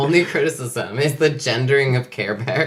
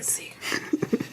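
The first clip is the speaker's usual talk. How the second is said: slow, soft whisper; secretive whisper; 8 dB quieter, slower than usual. Pretend. Cohost echoing the Secret Bear joke, slow whisper. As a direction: draw the secret out in a hushed whisper.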